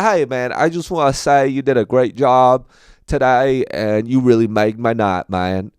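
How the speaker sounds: bad Southern accent. Southern fan praising the comedian after the show, bad Southern accent. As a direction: praise them like a friendly Southerner. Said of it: "I do a really bad".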